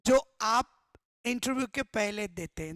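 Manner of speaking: ordinary talk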